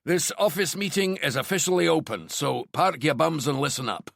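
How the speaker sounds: commanding Glaswegian; Glaswegian tinged with London. A narrator's reading. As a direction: open the meeting in a booming Glaswegian-London accent. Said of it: "in his Glaswegian tinged with London accent".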